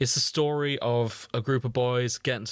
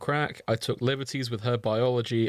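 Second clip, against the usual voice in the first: ordinary talk, nothing marked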